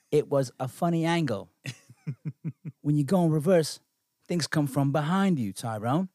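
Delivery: mock British scolding of a mate; put-on British comic patter; British accent. Co-host acts out a British bit to Tyrone, mock British accent. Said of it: "Their fucking accent's the best".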